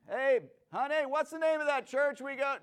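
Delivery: high, loud shout to someone off in another room; calling out to wife. Acted.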